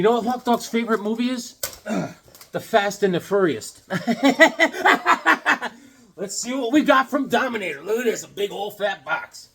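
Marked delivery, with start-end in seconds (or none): 3.9-5.7 long, high-pitched laugh; high cackling laugh. Real, not acted. Host laughing at his dog movie joke, cackling. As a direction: crack up at your own joke.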